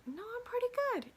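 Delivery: lightly voiced high breezy reassurance; a bright little all-good; mock relaxed self; calm self. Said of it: "to, like".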